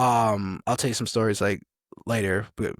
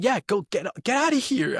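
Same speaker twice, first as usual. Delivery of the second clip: high-pitched mock yell, a little louder; impatient sergeant, imitated; 11 semitones higher, 4 dB louder than usual. Sergeant sends lost recruit away, impatient mock yell. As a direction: imitate someone shooing off a nuisance.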